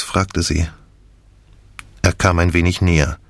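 ordinary delivery, nothing marked

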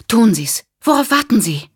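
high, loud, urgent push; an impatient woman cutting the captain off; urgent demand. Acted. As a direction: hurry him along, impatient. Rachel urgently cuts the captain off.

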